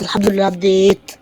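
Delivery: ordinary talk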